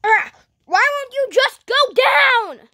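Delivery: high, straining battle cry; hammed-up fighting shout; exasperated yell. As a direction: demand it in mock frustration.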